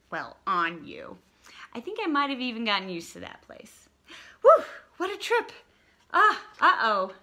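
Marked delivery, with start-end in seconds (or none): 4.4-7.1 loud, high relieved whew; excited whew. Acted. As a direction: blow out after a wild ride. After the ride in hair.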